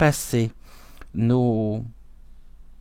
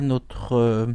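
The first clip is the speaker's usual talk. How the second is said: usual speaking voice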